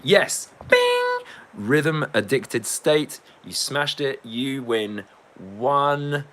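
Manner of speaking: high, loud, drawn-out winner announcement; mock quiz host. Quiz host awarding the point to a viewer, mock-triumphant. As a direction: announce the winner slowly and high, quizmaster style.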